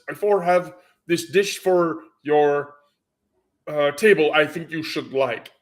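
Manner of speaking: imitating a heavy Russian accent; waitress line in a thick Russian accent. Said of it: "most direct Russian accent and says".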